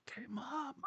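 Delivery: put-on high whispered teen answer to mom; whispered reply. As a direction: whisper back like a sulky kid. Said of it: "I'm like".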